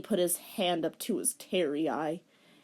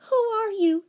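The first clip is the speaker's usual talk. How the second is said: put-on high girlish question, louder; 6 dB louder than usual. Shown as a demonstration. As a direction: ask it high, not yet angry. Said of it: "let me make it more angry".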